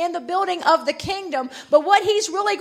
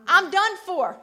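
ordinary talk

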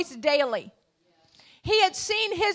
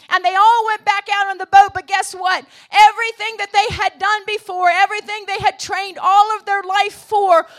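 loud, driving sermon build; full-force preaching; 5 dB louder than usual. Acted. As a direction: preach it out to the whole room.

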